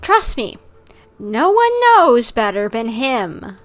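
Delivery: ordinary delivery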